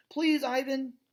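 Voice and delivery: put-on high little child's please; pleading child. Young elephant begs Ivan, high pleading child voice. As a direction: coax him like a small elephant.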